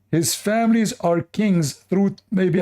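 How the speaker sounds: ordinary talk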